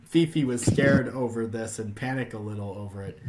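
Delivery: ordinary talk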